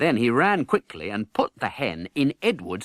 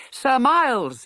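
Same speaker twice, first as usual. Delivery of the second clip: high, louder call for rescue; crying out; 5 dB louder than usual. Acted. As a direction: call to Miles over the crowd. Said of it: "he cried".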